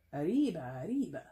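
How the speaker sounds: hammed-up Speedy Gonzalez Spanish accent; a deliberately terrible Spanish accent cheer; mock cartoon Spanish accent, rising on the second arriba; bad Spanish accent. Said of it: "That's a terrible Spanish accent".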